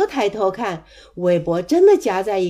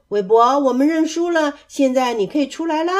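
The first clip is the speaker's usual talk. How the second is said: raised voice, quickening at the end; calling out; 6 semitones higher, 6 dB louder than usual. A narrator's reading. Elmer giving up the search, calling out. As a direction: call up to someone hidden. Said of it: "艾玛叫道".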